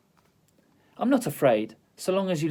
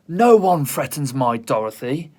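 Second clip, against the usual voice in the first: loud, defiant little-dog voice; small dog defending its owner; fierce bark; 7 dB louder than usual. Acted. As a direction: stand your ground and bark it out. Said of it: "barking wildly".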